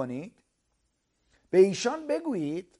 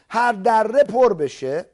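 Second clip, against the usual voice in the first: each word drawn out and louder; slow emphatic preaching; 3 semitones higher, 9 dB louder, slower than usual. Acted. Preacher explaining the valley to listeners, slow and emphatic. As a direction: lean on every word for the crowd.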